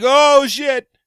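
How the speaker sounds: loud high cry of pain; pained yelp. Retold or imitated. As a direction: yelp like you just touched a hot plate. Doug burns his hand.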